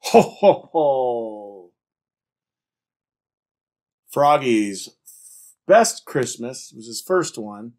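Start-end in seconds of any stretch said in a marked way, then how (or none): 0.0-1.7 big, loud ho-ho-ho; Santa-style ho-ho. A narrator's reading. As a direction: belt out a Santa laugh, last one drawn out. Santa Owl's laugh in story.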